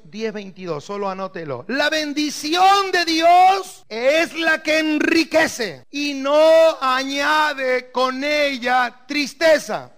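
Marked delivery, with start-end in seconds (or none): 1.7-9.9 preaching. Acted. Reads scripture aloud, preaching.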